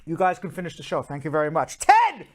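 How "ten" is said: high, loud delighted rave; excited. Real, not acted. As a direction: shout the rating with delight. Rates the rum cake.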